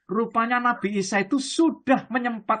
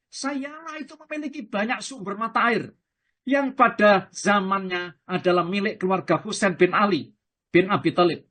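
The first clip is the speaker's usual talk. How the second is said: normal talking voice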